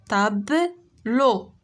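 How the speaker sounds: slow, syllable by syllable تابلو; slow clapping-out of تابلو; syllables drawn out. Shown as a demonstration. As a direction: stretch تابلو out so pupils hear each syllable. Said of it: "فين كنسمعو تي؟".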